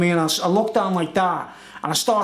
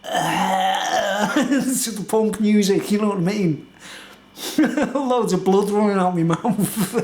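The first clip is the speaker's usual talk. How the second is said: laughing; much slower than usual. Real, not acted. Laughing recalling the prank.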